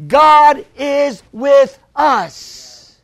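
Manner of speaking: 2 drawn-out, loud preaching shout; 3 proclaim it to the back of the church; 4 belting it out to the congregation, slow and loud. Real, not acted.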